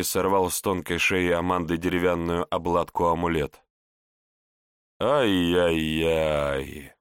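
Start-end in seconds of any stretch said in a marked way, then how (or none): 5.0-6.9 slow mocking tut-tut; tutting. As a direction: drag out a disapproving tut. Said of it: "подцокал языком отец Хубер".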